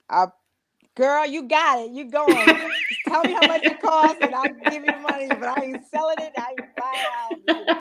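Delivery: teasing friend. Retold or imitated. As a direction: laugh through it like egging a bestie on. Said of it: "And I'd be like".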